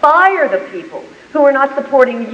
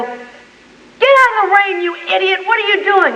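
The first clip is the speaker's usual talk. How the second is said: high-pitched mock yell at a dog; retold angry yell; 10 semitones higher than usual. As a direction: re-enact yelling at the dog, pitched way up. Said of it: "I'm yelling at my beloved dog".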